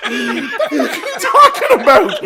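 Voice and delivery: high, slow, drawn-out protest; stretched-out mock bafflement; incredulous. Son answering dad's weird accusation, incredulous.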